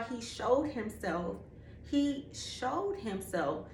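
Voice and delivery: slow, soft, weighty repetition; slow emphasis. Shown as a demonstration. Testifying to the audience, slow soft emphasis. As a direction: let each word land slowly. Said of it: "And when I tell y'all".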